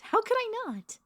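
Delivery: very high, loud cooing; gushing affection. Acted. Vampire doting on her silly pet, gushing high. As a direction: coo it high at a beloved pet.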